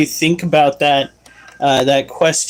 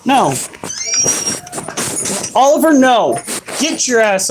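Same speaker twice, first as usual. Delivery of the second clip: yelling at dog; 7 semitones higher than usual. Real, not acted. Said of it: "That is why I am yelling".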